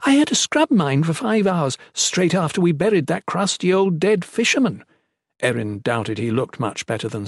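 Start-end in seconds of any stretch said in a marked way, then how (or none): 0.0-4.8 high, indignant grievance; high-pitched exasperated grumbling; complaining. A narrator's reading. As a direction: complain at length in a raised character voice. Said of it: "Erin complained to his plump companion".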